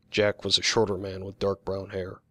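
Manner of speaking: usual speaking voice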